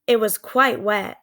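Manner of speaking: ordinary delivery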